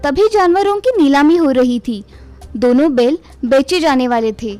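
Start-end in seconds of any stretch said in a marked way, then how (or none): none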